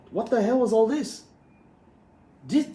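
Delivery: can't believe what he's reading, almost shouting; incredulous outburst. Real, not acted. Outraged at ban list changes.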